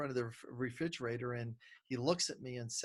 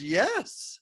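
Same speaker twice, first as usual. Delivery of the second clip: put-on high, louder eager kid's yes; six-year-old son; 9 semitones higher, 8 dB louder than usual. Dad imitates his son saying yes to ice cream, high and eager. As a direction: blurt it out like a keen little boy. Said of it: "He goes".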